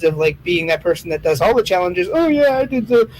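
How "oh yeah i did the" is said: high, smug show-off voice; mock bragging kid. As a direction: brag like a challenge chaser. Mocking challenge-doing people.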